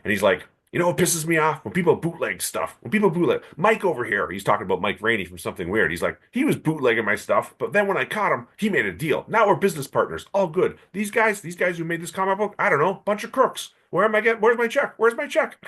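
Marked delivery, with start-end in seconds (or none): 0.7-4.3 high, indignant griping; imitated cranky rant; mock rant. [name] ranting. 6.3-15.6 high and climbing, ending in a demanding whine; imitating an old producer getting more and more indignant; building mock rant. [name] ranting, building up.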